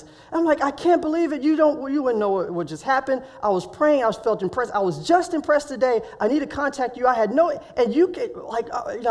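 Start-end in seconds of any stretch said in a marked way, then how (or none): 0.6-7.5 high, breakneck gushing; so excited. Retold or imitated. Man telling his calm friend about an answered prayer, excited. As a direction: tumble over the words in excitement. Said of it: "I was just so excited".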